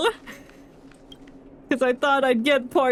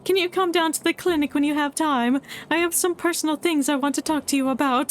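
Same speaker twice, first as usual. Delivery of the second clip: higher, polite character voice; voicing the NPC; 5 semitones higher than usual. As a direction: read it as the gentle clinic doctor, pitched up. Reads NPC's lines aloud.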